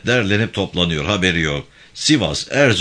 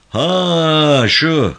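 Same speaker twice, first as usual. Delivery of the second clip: surprised; 9 semitones higher, 7 dB louder than usual. Acted. Suddenly recognizes the visitor.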